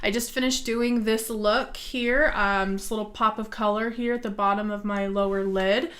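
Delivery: ordinary talk, nothing marked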